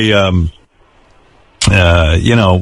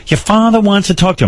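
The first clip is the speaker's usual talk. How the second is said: mother's voice; 9 semitones higher than usual. Retold or imitated. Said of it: "my mother goes".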